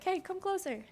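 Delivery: high, soft imitation of a yelling little character; mock little-dude yell. Little narrator robot prompting the player to move, small mock yell. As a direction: call out in a tiny, high-pitched voice, without real volume. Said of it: "this little dude just yelling at you".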